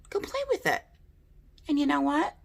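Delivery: squeaky high helium voice. Acted. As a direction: talk as if you breathed helium. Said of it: "with my helium voice".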